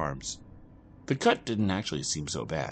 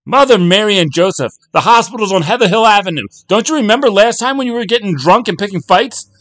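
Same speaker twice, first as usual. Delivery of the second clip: very high, loud sarcastic tirade; a wife in labour berating her husband; exasperated yelling; 13 semitones higher, 14 dB louder than usual. Acted.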